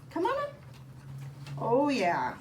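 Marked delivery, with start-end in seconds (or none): 0.1-0.5 high, bright pet-talk coax; high coaxing call to the dog. Real, not acted. Calling the dog up.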